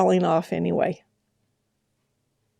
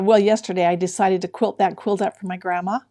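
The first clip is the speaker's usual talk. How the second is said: ordinary talk, nothing marked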